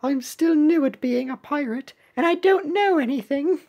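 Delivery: high, innocent cartoon voice; novice pirate cookie. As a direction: plead ignorance in a little high voice.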